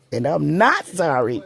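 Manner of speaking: normal talking voice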